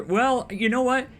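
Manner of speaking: ordinary delivery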